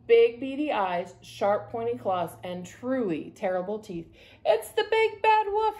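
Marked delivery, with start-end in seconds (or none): 4.4-5.8 high cry of alarm. A narrator's reading. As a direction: cry out in panic at the wolf. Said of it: "he cried".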